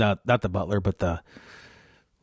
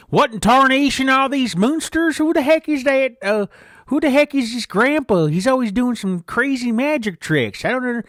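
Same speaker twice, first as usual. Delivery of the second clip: Idaho camper voice; 13 semitones higher, 4 dB louder than usual. Retold or imitated. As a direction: squeal it like an excited Idaho yokel. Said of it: "This is how the guy talked".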